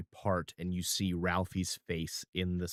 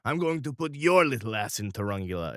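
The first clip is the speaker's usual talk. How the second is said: louder, fast furious retort; angry refusal; 4 semitones higher, 5 dB louder than usual. Acted. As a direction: snap back at the boy. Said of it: "Mister R goes".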